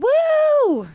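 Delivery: a sudden high, loud whoop of joy; gleeful cheer. Retold or imitated. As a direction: cheer like you just got engaged. Excited bride-to-be cheer.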